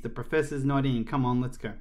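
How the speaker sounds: ordinary delivery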